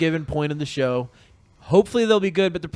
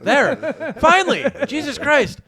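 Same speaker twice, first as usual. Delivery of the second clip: laughing; 10 semitones higher, 3 dB louder, slower than usual. Real, not acted. Relieved laughing outburst.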